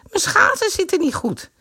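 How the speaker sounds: high sweet asking; girlish voice. A narrator's reading. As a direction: ask him politely. Girl complaining.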